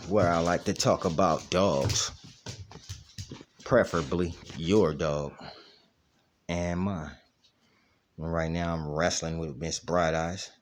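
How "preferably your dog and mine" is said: ordinary talk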